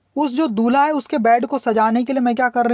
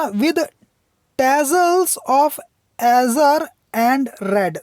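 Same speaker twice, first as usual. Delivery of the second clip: poem reading; 3 semitones higher, much slower than usual. Shown as a demonstration. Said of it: "teacher reads poem line slowly".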